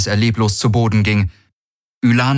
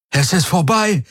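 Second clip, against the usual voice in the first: higher, relieved call; 10 semitones higher than usual. A narrator's reading. Rescued soldier calls out that it is over. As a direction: cry it out in relief. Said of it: "rief er".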